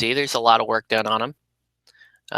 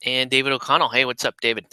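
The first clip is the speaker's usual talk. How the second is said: usual speaking voice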